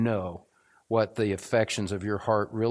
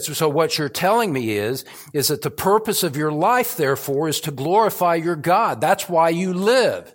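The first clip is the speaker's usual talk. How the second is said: excited retold question; 7 semitones higher, 7 dB louder than usual. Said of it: "with a Russian accent".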